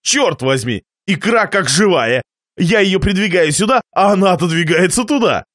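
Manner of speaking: ordinary delivery, nothing marked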